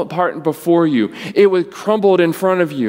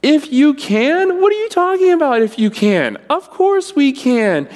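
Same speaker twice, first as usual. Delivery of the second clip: incredulous Jesus; 11 semitones higher, 3 dB louder than usual. Retold or imitated. Jesus answering the father's doubt, astonished. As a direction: throw the question back in disbelief. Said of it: "Jesus responds and says".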